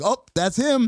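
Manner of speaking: high-pitched spotting of someone familiar; acted-out pleased discovery; delighted recognition. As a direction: pipe up as if catching a glimpse of him. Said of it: "these little glimpses like".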